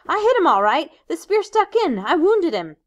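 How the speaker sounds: loud, high and breathless with pride; excited. Acted. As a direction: gush about the hit. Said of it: "Ralph talked on excitedly".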